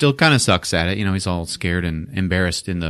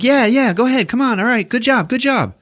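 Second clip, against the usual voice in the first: bright coach-like cheering, much higher; cheering kids on. Retold or imitated. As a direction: hype up the little kids playing football. Greg cheering on little kids.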